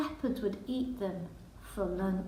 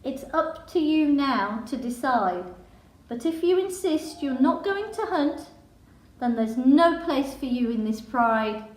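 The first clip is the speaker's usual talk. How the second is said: loud, firm ultimatum from a mother; stern lioness mother giving her son an ultimatum; stern mum; 5 dB louder than usual. Acted. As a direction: warn him louder and louder as the threat lands. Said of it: "said Leo's mum sternly".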